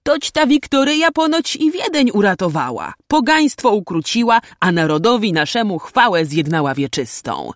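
high, loud proud proclamation, settling toward the end; lively, stirred-up exclaiming; suddenly animated. A narrator's reading. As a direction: come alive while praising a victory. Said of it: "ożywił się niespodziewanie".

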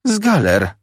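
high, exclaimed retort; exasperated answer. A narrator's reading. Sister answers the boy's question, exasperated answer. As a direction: throw the answer out on a high pitch.

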